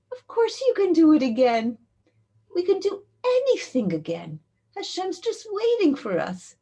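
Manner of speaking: high, loud, emphatic encouragement; impassioned reassurance. Retold or imitated. Woman assures the girl she can start over, impassioned. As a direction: insist on it with full conviction.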